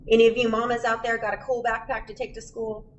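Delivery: ordinary delivery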